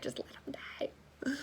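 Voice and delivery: breathy whispered plea, slow; mock whisper. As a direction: whisper it like a secret wish. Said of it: "I was just like".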